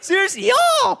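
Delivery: a wild puppet wolf yelling; high puppet voice. Acted.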